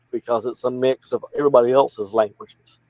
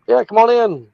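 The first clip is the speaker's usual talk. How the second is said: mock welcome; 11 semitones higher, 4 dB louder than usual. Mocking government's welcome to immigrants.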